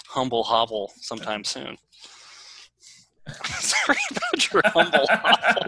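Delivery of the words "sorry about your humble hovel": high-pitched words squeezed through laughter; laughing apology. Real, not acted. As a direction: apologise while cracking up. Laughing at own phrase.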